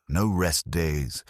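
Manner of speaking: ordinary delivery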